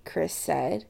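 plain reading voice

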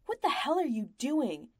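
high, loud furious scream. A narrator's reading. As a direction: scream at the boy in pain and rage. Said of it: "the merchant screams".

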